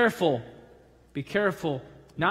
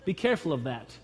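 normal talking voice

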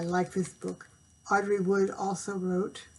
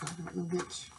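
ordinary delivery

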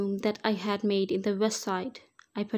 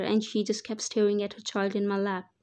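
plain reading voice

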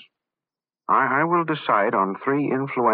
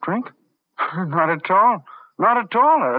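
laughing; 6 semitones higher, 4 dB louder, slower than usual. Acted. Poisoner laughs at drink request.

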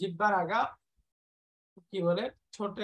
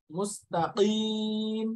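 slow recitation; 3 dB louder, much slower than usual. Real, not acted. Teacher recites word slowly.

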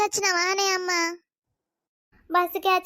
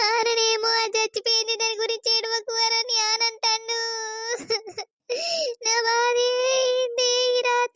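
tearful wailing. Acted. Mother grieves over daughter and husband's words, wailing. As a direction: pour out grief in sobbing wails. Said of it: "నువ్వు ఏడవకే".

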